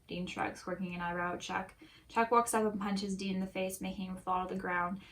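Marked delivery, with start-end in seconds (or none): none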